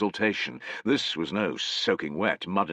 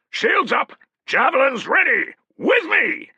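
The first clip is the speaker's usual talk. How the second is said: high, loud battle commands; barked orders; 8 dB louder than usual. Acted. Centurion ordering the charge, high and loud.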